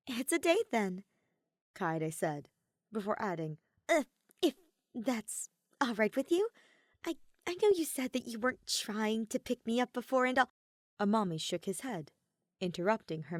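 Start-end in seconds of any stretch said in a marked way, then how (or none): 0.1-1.0 Kaede's voice. Acted. 3.9-10.5 Kaede's voice. Acted.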